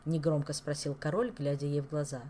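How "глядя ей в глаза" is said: plain reading voice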